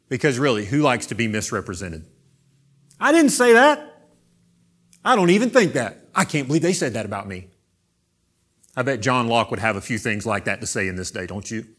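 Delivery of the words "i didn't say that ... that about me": outraged at being misquoted, higher and louder; indignant protest. Acted. Jesus voiced as misrepresented.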